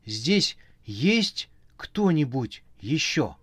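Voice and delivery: slow, scared call into darkness; desperate. A narrator's reading. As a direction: ask in despair, slowly. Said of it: "в отчаянии спросил".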